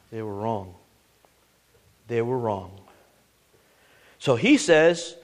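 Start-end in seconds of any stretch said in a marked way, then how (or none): 0.1-0.7 hushed verdict. Real, not acted. Preacher to congregation, the sinners' verdict hushed and grave. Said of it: "that they were what?". 2.1-2.8 soft repeat. Real, not acted. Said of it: "that they were what?".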